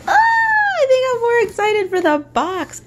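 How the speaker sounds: high, loud squealing cry; excited squeal. Real, not acted. Thrilled by the box, squealing loudly and high. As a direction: shriek it with delight.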